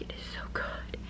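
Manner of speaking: hushed, gushing whisper. Real, not acted. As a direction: confide how good it is. Schwärmt vom Buch.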